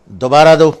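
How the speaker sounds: loud, high, forceful order; stern mother's loud command. Retold or imitated. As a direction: imitate mother ordering sharply at full voice. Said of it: "انہوں نے بڑے زور سے کہا".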